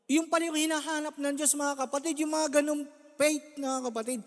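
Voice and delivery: put-on preaching to the faithful about childlike faith; fervent preaching. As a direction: drive the point home to the congregation. Preaching rising.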